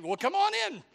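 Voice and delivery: high, loud, welcoming sheep voice; gullible sheep. Acted. Sheep inviting the disguised wolf in, high gullible call. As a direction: call out an eager, silly invitation. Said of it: "the sheep saying".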